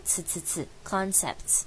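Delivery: "呲" as a hissed ts, then "concepts" ending in ts; hissing plural ts. Shown as a demonstration. Teacher drills the ts ending of "concepts". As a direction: stress the final ts hiss of "concepts". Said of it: "所以是要念呲".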